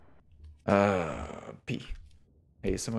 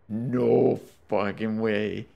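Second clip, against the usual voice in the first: stunned disbelief; 6 dB louder, much slower than usual. Real, not acted. Repeats disbelief after jumpscare.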